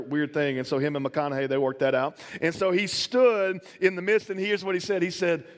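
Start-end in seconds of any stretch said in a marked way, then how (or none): none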